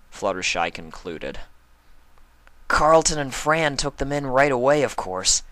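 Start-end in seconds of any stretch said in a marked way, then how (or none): 2.7-5.4 higher and louder, confident; a cocky pony sharing gossip; louder, higher brash pony voice; brash tomboy pony. Acted. Narrator voices Rainbow Dash.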